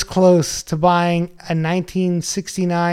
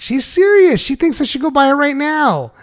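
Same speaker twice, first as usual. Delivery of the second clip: higher-pitched insistence; retold insisting; 7 semitones higher than usual. Husband defending his wife's text to coworkers, retold insisting. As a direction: argue back, pitch lifted, mock earnest.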